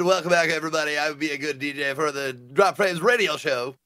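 hyped morning-show patter; put-on typical DJ delivery; radio DJ voice. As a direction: announce it like a cheesy radio host. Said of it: "when you do that voice like that".

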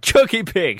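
high mock Dorset accent; mimicked Dorset farmer. Host quoting the Dorset candidate's best line, high mimicked accent. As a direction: quote it in an imitation West Country voice. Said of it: "best lines that he said".

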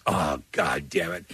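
low, slow, resigned curse; exasperated dad. Retold or imitated. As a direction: drag it out low, like a weary dad. Retold dad hears bad news.

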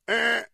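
high, loud buzzer Eh; a sharp mock alarm. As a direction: buzz it like a game-show wrong answer.

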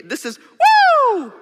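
high, loud, slow drawn-out woo; Ric Flair woo. Shown as a demonstration. Speaker shows the crowd the Ric Flair, long high woo. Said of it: "Let me show you".

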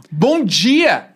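bright good morning out the window; cheery morning greeting. Pretend. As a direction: greet the day. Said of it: "abrir a janela e falar".

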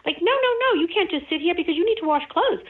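high, fast, fretful inner chatter; nagging ego voice. Retold or imitated. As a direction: play the anxious ego butting in. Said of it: "your ego saying".

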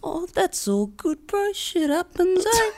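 high-pitched, imitated easygoing bloke; unbothered Kiwi, high mock voice; easygoing New Zealander.

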